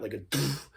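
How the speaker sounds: soft beatbox kick, „doof“ blown out; soft doof bass sound. Shown as a demonstration. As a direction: blow a short doof. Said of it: "you could do it like that".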